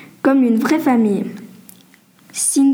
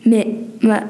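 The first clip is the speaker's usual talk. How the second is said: halting, questioning surprise; surprised; slower than usual. A narrator's reading. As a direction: stumble into a mildly surprised question. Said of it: "dit Elodie surprise".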